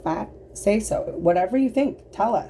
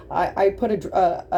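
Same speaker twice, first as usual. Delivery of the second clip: ordinary talk, nothing marked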